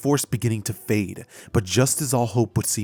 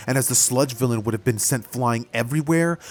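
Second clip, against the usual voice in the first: usual speaking voice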